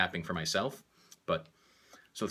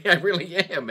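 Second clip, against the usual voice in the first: laughing it out, higher and louder; 10 semitones higher, 8 dB louder than usual. Real, not acted. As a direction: laugh at yourself getting worked up. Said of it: "I'm getting pissed".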